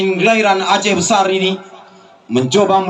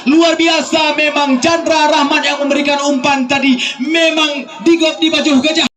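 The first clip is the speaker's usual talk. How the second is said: excited commentary; 7 semitones higher than usual. Real, not acted. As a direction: celebrate the goal to the crowd. Celebration after the goal.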